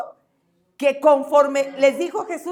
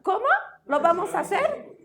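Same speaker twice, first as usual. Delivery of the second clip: high-pitched confused student; lightly mocked puzzled schoolkid voice; clueless pupil; 6 semitones higher than usual. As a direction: ask the teacher as if you missed everything. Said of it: "Y no falta quien diga".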